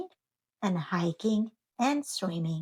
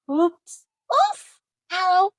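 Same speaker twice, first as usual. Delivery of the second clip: pained yelps; 10 semitones higher, 7 dB louder, slower than usual. Acted. Character stumbles and falls.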